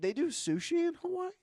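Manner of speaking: squeaky voice crack on the question; startled high-pitched squeak. Real, not acted. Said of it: "Why did I do that?".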